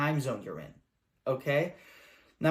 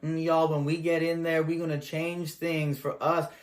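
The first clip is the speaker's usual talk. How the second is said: mock street slang, a touch slower. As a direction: candidate pandering in a slangy voice.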